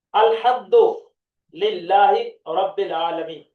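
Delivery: slow, measured Quranic recitation; recited verse. Shown as a demonstration. Teacher recites the verse to his students, slow chant. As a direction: chant the opening words slowly and loud.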